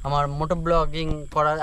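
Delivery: ordinary talk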